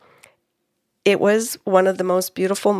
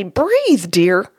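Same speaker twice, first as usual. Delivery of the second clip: wrong tone. Retold or imitated. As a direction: BREATHE pitched up, too insistent. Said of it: "or I couldn't say".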